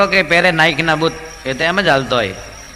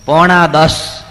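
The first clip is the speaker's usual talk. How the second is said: blurted out much louder; loud answer; 9 dB louder, much slower than usual. Retold or imitated. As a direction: bark the time over headphones. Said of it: "આટલું જોરથી શું કામ બોલે". (વોકમેનવાળાનો જોરદાર જવાબ)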